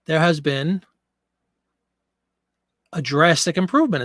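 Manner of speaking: ordinary delivery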